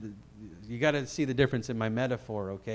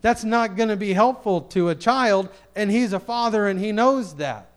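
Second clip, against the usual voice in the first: higher, louder preacher's insistence; emphatic preaching; 6 semitones higher, 6 dB louder than usual. Real, not acted. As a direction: drive the point home to the congregation.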